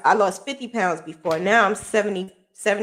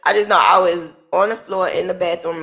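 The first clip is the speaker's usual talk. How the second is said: ordinary talk, nothing marked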